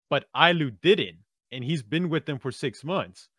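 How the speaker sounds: ordinary talk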